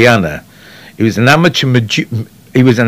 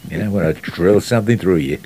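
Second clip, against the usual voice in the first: low, lazy American impersonation; Henry Fonda; 3 semitones lower, 7 dB quieter than usual. Shown as a demonstration. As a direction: throw the lines away, low and easy. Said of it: "And it was like this".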